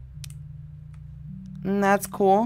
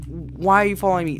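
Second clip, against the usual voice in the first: usual speaking voice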